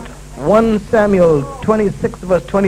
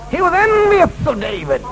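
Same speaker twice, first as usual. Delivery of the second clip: ordinary delivery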